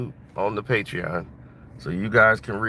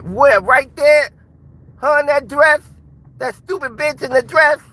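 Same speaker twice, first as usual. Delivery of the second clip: much higher, louder put-on boxer voice, seething; Mike Tyson; 8 dB louder than usual. Tyson fuming at the people who robbed him, high-pitched and loud. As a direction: play the champ fuming at being robbed, pitched way up. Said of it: "They'll be like".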